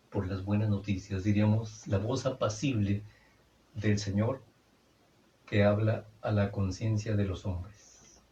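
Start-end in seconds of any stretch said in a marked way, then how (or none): none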